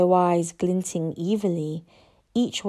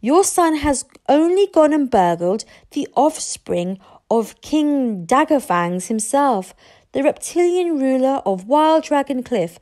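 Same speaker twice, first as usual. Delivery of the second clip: higher-pitched grand proclamation; eager young friend, solemn; 6 semitones higher, 4 dB louder than usual. Acted. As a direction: announce the royal lineage to impress.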